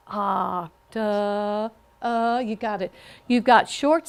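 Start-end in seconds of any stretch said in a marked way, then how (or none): 0.1-2.4 OCTA-UH drawn out syllable by syllable; sounding out. Shown as a demonstration. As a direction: stretch each sound of "Octa-, uh" for the class.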